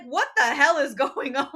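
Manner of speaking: loud, baffled mock outburst; exasperated bewilderment. Percy baffled by cryptic taxi sisters, loud mock exasperation. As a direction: punch it out in acted disbelief, breaking into a laugh.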